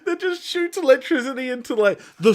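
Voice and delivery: high, strained voice close to laughing tears; squeaky, barely holding it together; suppressed hysterics. Real, not acted. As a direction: push the explanation out through a laugh-tightened throat. Tries explaining absurd mop while cracking up.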